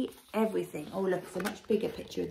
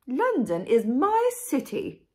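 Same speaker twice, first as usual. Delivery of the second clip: slow, loud, raised regal voice; grand queen; 4 semitones higher, 7 dB louder than usual. A narrator's reading. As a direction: proclaim it like royalty. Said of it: "said the Queen".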